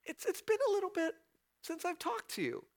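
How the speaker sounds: high, quick, awkward and apologetic; embarrassed believer tiptoeing back to God; sheepish prayer. Retold or imitated. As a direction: sheepishly admit the long silence. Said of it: "or you say".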